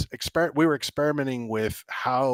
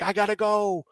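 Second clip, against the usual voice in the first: high-pitched retold plea; imitated urgency; 10 semitones higher than usual. Shaking player telling the developer he must leave, imitated urgency. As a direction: mimic a player needing out. Retelling panicked player needing out.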